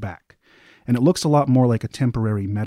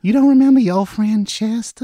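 high, louder, playful creature voice; soft mimic voice; 11 semitones higher, 5 dB louder than usual. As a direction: tease him in the mimic's high voice. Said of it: "a very soft voice".

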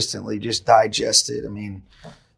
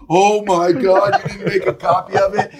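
retold shock; 7 semitones higher, 7 dB louder than usual. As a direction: imitate a coworker's horrified disbelief, half laughing. Retells colleague's shocked reaction.

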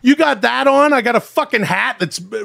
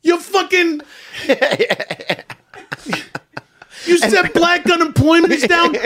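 higher, furious rant with cursing at the president; ranting Rappaport impression; 6 semitones higher than usual. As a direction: spit out insults like a hot-headed New Yorker. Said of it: "it sounds like somebody stuffed that movie, uh, Casino".

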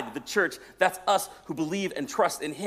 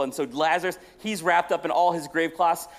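ordinary delivery

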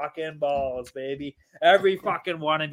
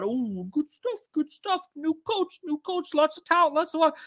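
mock-cheerful singsong, much higher, rising on each line; mocking fan voice; 11 semitones higher than usual. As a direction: parrot the hopeful fans in a high sneering lilt. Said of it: "you can say all this one".

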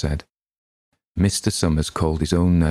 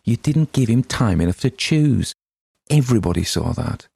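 a higher, broken plea, slowing on wasn't fair; desperate plea; 5 semitones higher than usual. A narrator's reading. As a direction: stammer it out desperate and pleading. Tessie pleads against the draw.

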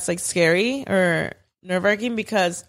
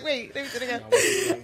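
laughing; 6 semitones higher, 5 dB quieter than usual. Retold or imitated. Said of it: "I dead ass was like".

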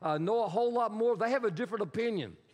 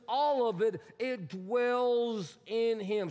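"dwells" drawn out slowly in "it dwells in him"; slow, weighty stress on "dwells"; emphatic; much slower than usual. Shown as a demonstration. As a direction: stretch "dwells" for weight, then land "in him". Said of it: "So he's emphasizing".

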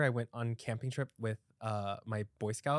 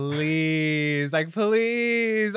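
loud, high mock exasperation; exasperated plea; 4 semitones higher, 8 dB louder, much slower than usual. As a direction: draw out each please, louder and higher, at a dramatic partner. Exasperated reply to dramatic boyfriend.

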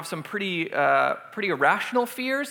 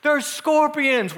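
high, drawn-out mock alarm; hammed-up frightened announcement; panicked warning; 7 semitones higher, much slower than usual. Retelling the warning to the girls' room, mock panic. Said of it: "we're telling them".

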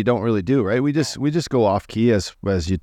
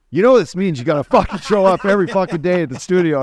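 higher-pitched mock rant, punching the swearing; retold angry yelling; 7 semitones higher, 4 dB louder than usual. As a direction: act out yelling at a colleague on the phone. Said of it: "I'm yelling at him".

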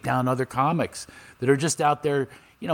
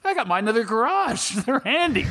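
cheery son; 11 semitones higher than usual. Retold or imitated.

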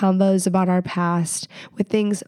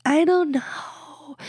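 hesitant friend; 13 semitones higher, much slower than usual. Retold or imitated. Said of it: "they might be like".

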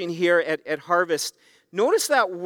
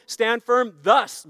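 slow and driving, "thus" rising; stress on thus; 3 semitones higher, slower than usual. Shown as a demonstration. Preacher urging his church to move forward, driving slowly on "thus". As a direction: push "thus" forward with energy. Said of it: "the word "thus" actually means forward progress".

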